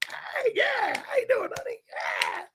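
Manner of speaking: high breathy flirting whisper. Retold or imitated. Flirting with the bar women in a high mocking voice. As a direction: coo at her, mocking himself.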